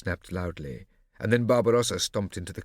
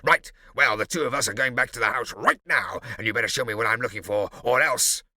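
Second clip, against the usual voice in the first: loud threatening bully; bully her with loud threats; angry Barbarossa; 4 semitones higher, 6 dB louder than usual. A narrator's reading. Barbarossa threatening Morosina, loud and angry.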